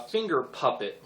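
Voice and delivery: ordinary delivery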